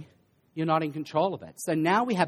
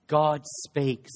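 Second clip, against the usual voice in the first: ordinary talk, nothing marked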